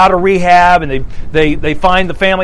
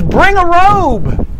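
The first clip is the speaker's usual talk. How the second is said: slow, drawn out, much higher call; calling out to servants; 10 semitones higher, much slower than usual. Acted. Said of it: "he yells to his servants".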